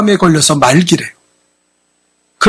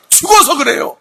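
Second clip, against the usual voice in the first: shout; 8 semitones higher than usual. Real, not acted. Preacher rebukes dead faith.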